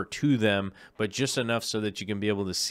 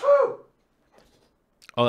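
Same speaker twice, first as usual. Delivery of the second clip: sudden loud real sneeze; full-on sneeze; 7 dB louder than usual. Real, not acted. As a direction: let a big sneeze burst out. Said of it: "that was a full-on sneeze".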